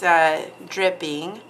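ordinary talk

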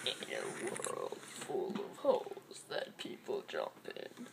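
very low, slow, breathy trailer narrator; movie trailer voice. Pretend. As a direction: intone it like a film trailer. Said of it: "My voice sounds so deep when I do that".

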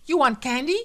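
loud, high frantic offer; wild. Acted. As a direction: blurt the question wildly. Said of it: "she said wildly".

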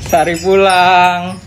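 low, slow, drawn-out call; calling a child home. Acted. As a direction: stretch the words out to summon the child. Parent calls child home. (panggilan pulang yang panjang)

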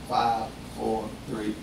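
low, soft, slow count down; countdown. Shown as a demonstration. Preacher counts down to the house exploding, quiet and slow. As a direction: hush it like a bomb timer.

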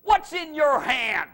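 loud, slow, high rebuke; God scolding a frightened Moses; commanding and impatient; stern rebuke. Retold or imitated. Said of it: "basically God said".

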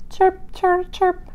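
high, soft chirps. Acted. Voicing Oscar's happy chirping, high and soft.

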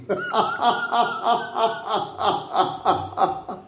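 long, very high whooping laugh; laughter yoga laugh. Shown as a demonstration. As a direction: belly laugh that climbs and keeps going. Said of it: "I invite all of you to laugh".

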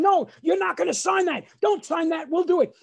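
high, frantic screech of refusal; screaming mother. Retold or imitated. Mother forbidding son to sign the agreement, screaming. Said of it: "my mother starts screaming".

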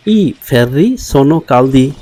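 very slow, each word laid out clearly; slow teacher demo; slow model reading. Shown as a demonstration. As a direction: spell the sentence out slowly for learners. Teacher models Italian sentence.

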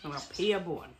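usual speaking voice

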